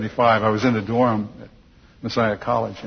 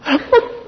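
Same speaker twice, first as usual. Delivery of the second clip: burst of laughter; 4 dB louder than usual. Real, not acted. Asked to lead prayer, laughs.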